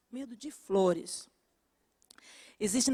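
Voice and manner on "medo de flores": repeated much softer, almost to herself; soft echo. Shown as a demonstration. Preacher softly repeating the phobia, hushed. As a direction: drop the voice for the echo.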